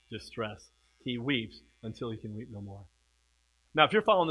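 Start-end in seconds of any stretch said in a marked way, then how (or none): none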